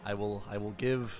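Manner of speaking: ordinary delivery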